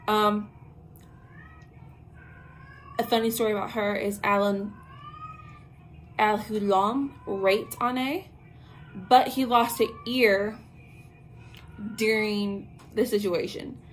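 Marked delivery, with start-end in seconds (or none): none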